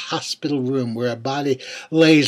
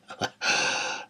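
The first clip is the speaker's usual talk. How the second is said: quiet breathy chuckle; a brief knowing laugh under the breath; soft chuckle; 6 dB quieter than usual. A narrator's reading. Narrator laughs at "magic number.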